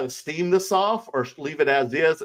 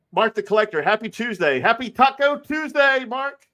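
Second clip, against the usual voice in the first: excited shoutout; 9 semitones higher, 3 dB louder than usual. Real, not acted. Cheery shoutout to chat viewer.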